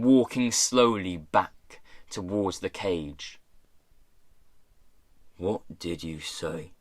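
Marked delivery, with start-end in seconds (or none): 5.4-6.7 low, hushed challenge; quiet menace. A narrator's reading. Pirate boss walking back to the cage, quietly menacing. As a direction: ask it low and soft, dangerously.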